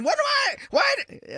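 high mock panicked cry; imitating a scream. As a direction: squeak it out like the startled guy. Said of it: "He was just screaming".

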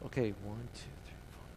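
quietly tallying the customers ahead; muttering to himself. Retold or imitated. Man in line counts ahead to himself, softly muttering. Counting people under his breath.

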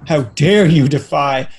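drawn-out mock indignation; hammed-up offended how dare you; mock outrage. Touchy critics answering any negative remark, mock outrage.

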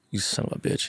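hushed, voiceless mock cursing; retold muttered curse. Agent cursing out his nervous client, retold in a hushed voice. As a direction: quote the curse softly under your breath.

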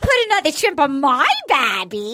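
broad, hammed-up Aussie twang; playful over-the-top Australian accent; fake Australian accent. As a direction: do a cartoon Australian. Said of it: "My friend who's Australian".

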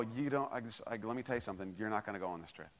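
low, hushed and firm; quiet, no-nonsense verdict to the caller; firm quiet warning. Retold or imitated. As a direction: lean in and lay down the law softly. Pastor firmly confronts caller.

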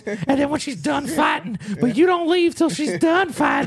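mock a sweet old man savoring murder instructions; creepy high-pitched elderly impression; sinister old man. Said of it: "He's like".

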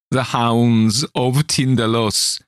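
name the horror in terror; shrieked warning. Acted. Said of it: "he shrieked".

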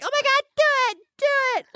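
very high squealing mock excitement; excited girls. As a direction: squeal like thrilled girls egging someone on. Imitates excited girls begging.

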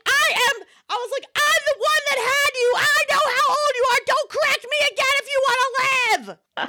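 very high, hammed-up exasperated threat; mock furious mom. Mom threatening her toddler in jest, very high and exasperated. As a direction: play the mom at her wits' end.

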